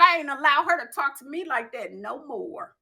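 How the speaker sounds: ordinary delivery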